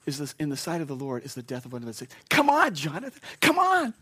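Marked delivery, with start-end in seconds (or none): 2.3-3.9 very high, loud, urgent "come on"; eager urging. Acted. Jesus eagerly calling Jonathan to heaven, high and urgent. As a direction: beckon him home with excitement.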